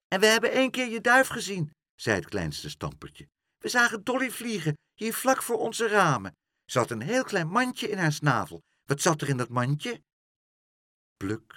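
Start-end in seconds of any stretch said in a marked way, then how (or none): 0.1-1.7 put-on high, fast small-child voice; little child. The smallest Stamper tells Pluk about the pigeon, high eager child voice. 3.6-10.0 put-on high, fast small-child voice; little child. The smallest Stamper tells Pluk about the pigeon, high eager child voice.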